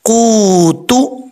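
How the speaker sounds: कूतु ending in a clear u, slowly drawn out; correct pronunciation. Shown as a demonstration. Teacher correcting कूतो to कूतु, slow recitation. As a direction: stretch कूतु, ending on u and not o. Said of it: "अब इसको कूतो नहीं पढ़ना".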